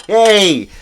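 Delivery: loud high whoop of yay; celebratory cheer. Real, not acted. Celebrating a year cancer-free.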